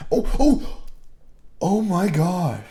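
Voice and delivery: sudden loud high alarm; gasping oh my gosh, slowed; surprised. Real, not acted. Software crash, unsaved work.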